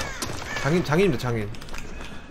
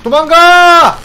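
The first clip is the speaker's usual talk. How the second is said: loud high yell to run away; panicked shout; 11 semitones higher, 12 dB louder, much slower than usual. Real, not acted. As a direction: scream it in panic. Chased in game, yelling to flee.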